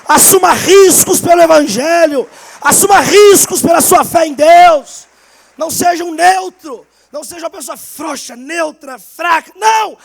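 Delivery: loud, slow, high preacher's exhortation; preaching at full force. Acted. Pastor urging the church to take risks, full-force preaching. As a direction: preach it like a revival call.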